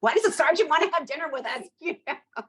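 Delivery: high, laughing through words; amused laughing aside. Real, not acted. Laughing at own story.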